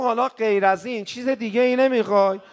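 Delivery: a loud, urgent ask; pleading question. Acted. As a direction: lift it and push it out. Preacher voicing the question, building.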